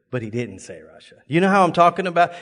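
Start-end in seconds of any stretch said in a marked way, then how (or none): 0.1-1.2 much softer, lower dropped aside; hushed aside. Real, not acted. Preacher confiding to the congregation, hushed low. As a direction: drop it low like a secret. Quiet aside about God's word.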